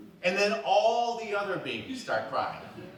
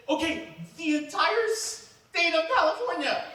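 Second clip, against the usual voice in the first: pitched way up, eager; excited; 13 semitones higher, 4 dB louder than usual. Retold or imitated. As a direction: go big, eager and much higher. Escalating ambition again.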